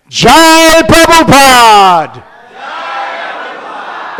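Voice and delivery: high, loud and slow jubilant shout; enthusiastic cheer. Acted. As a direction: cheer it out with the whole crowd. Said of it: "they said it with such enthusiasm".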